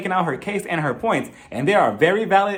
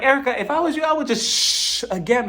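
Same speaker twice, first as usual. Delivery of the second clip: high scolding; 8 semitones higher than usual. Retold or imitated. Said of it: "And I'm like".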